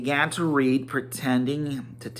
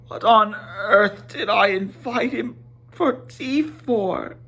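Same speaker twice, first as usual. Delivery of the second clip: higher, fretful hobbit muttering; Bilbo to himself; 9 semitones higher than usual. Acted. Bilbo alone regretting the invitation, higher and fretful. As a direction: scold himself in dismay. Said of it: "he said to himself".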